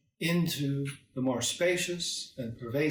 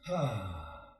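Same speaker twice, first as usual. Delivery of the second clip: long falling "ah" sigh, sinking low; relaxing sigh; 4 semitones lower, 4 dB quieter than usual. Shown as a demonstration. Teacher showing how to breathe out and relax, long sigh. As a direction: let the breath go in an audible sigh. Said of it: "just let out a sigh".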